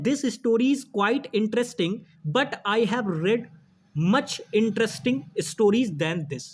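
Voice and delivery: slow, word by word reading; slow dictation. Shown as a demonstration. Teacher dictating the exam question, slow and deliberate. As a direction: read the faulty sentence slowly for the class. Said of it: "कह रहा है".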